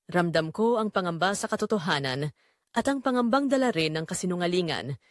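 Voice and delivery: plain reading voice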